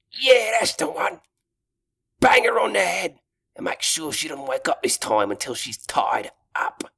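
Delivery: wheedling minion orc; squeaky orc voice. Acted. Second orc answers.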